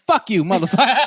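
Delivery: loud, high cursing with laughter; mock angry shout. Son calling his father back, laughing mock yell. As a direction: shout the insult, half laughing.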